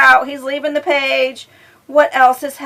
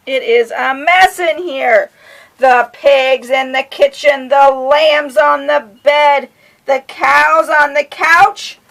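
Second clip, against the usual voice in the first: higher, louder, building frazzled rhyme; dramatic read-aloud; 4 semitones higher, 7 dB louder, slower than usual. A narrator's reading. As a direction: read it like everything's going wrong.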